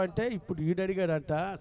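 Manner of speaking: ordinary talk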